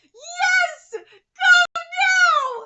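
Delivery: high, very loud victory cry; ecstatic cheer. Acted. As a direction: scream it with joy. Reading a cheering crowd line.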